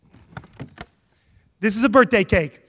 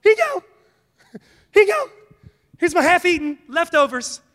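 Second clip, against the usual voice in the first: mock offering; 5 semitones higher than usual. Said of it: "we're like".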